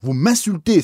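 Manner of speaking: high, loud furious outburst; outraged cry. Acted. Bishop crying out in fury at the priest's insult, high and loud. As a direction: explode at the insult. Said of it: "s'écria l'évêque".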